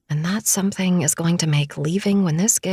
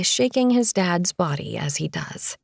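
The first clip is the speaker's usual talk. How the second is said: plain reading voice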